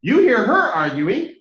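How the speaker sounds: ordinary talk, nothing marked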